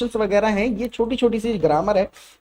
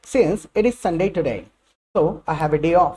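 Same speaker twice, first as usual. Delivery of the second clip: slow model sentence; much slower than usual. Shown as a demonstration. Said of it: "देखिए कितने तरीके से कहा जा सकता है".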